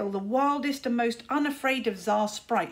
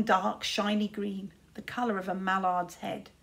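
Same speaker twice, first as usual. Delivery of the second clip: usual speaking voice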